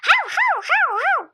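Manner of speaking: high, loud yapping barks; dog barking. Acted. Child playing the dog Sofiş barks high and loud. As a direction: bark like a small dog.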